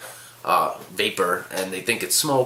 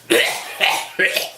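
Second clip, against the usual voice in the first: repeated hammed-up hacking; fake choking cough. Old lady cutting off his apology, fake choking cough. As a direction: choke theatrically, clearly faking. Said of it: "doing this fake choke thing".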